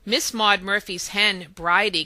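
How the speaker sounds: plain reading voice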